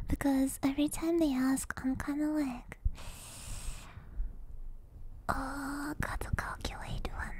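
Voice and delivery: soft breathy whisper close to the mic; ASMR whisper. Acted. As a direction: whisper as if lulling viewers to sleep. Whispered ASMR stream chat.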